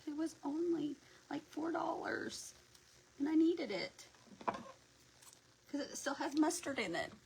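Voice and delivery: high-pitched playful self-justification; mock defensive. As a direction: plead your case to your husband in a mock high voice. Acted reply to scolding husband.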